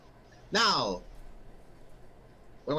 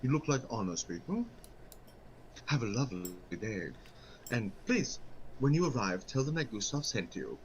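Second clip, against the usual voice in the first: deep, dropped sly merchant voice; low voiced merchant; 7 semitones lower, 4 dB quieter than usual. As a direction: drop the voice low and smooth. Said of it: "his voice completely just drops".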